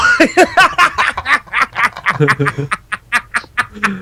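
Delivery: long helpless laughing fit. Real, not acted. Host laughing at the hermaphrodite prank, a real laugh. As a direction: laugh until you run out of breath.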